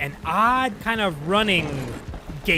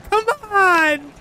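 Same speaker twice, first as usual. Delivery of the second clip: exasperated shout; 13 semitones higher, 7 dB louder than usual. Real, not acted. Urging the slow wagon.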